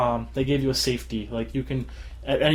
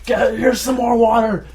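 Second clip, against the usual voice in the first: hammed-up bullying shout, higher and louder; mock tormentor; 9 semitones higher, 6 dB louder than usual. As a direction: play the cruel guard with glee. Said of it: "I'd be like".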